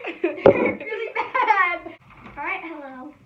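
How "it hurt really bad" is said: laughing through it, slow and loud. Real, not acted. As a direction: say it while cracking up. Laughing after getting hurt.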